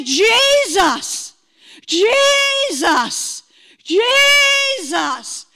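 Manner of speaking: high, loud, long-drawn calling out; retold tearful cry. Woman retelling her conversion, crying out to Jesus. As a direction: cry out the name, stretching it each time. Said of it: "I began to cry and say".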